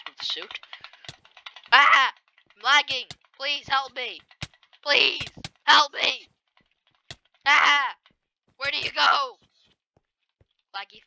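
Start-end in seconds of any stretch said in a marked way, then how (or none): 1.7-6.3 higher and louder, frantic cries for help; panicked yelling. Real, not acted. Lagging player panicking at the fight, frantic yells. 7.4-9.4 panicked cry. Real, not acted. Panicking mid-fight while lagging.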